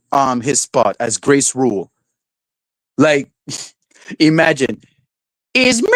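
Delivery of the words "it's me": high squeaky Mickey Mouse mimicry; mock her with a squeaky cartoon voice; voz de Mickey Mouse. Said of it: "with her Mickey Mouse voice".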